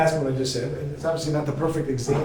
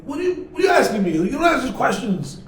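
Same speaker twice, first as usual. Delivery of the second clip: indignant father; 7 semitones higher, 4 dB louder than usual. Retold or imitated. Father snaps at nosy son.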